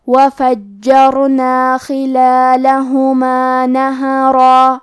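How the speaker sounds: slow, measured Quran chant; Quran recitation. Shown as a demonstration. Teacher recites the verses for students, slow tajweed chant. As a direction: chant the verses slowly and evenly.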